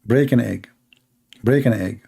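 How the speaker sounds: low, monotone recorded instruction; droning audio lesson; stuck CD voice. Retold or imitated. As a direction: repeat it like a stuck CD player. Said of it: "It says".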